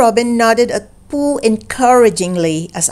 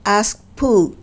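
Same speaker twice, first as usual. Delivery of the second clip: usual speaking voice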